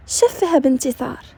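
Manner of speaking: plain reading voice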